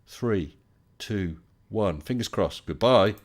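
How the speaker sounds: ordinary talk, nothing marked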